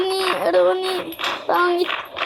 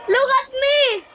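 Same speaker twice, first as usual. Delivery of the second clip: mock crying; 8 semitones higher, slower than usual. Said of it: "I'm crying".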